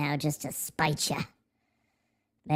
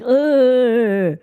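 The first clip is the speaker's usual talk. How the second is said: mocking chat; 6 dB louder than usual. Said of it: "You're all like".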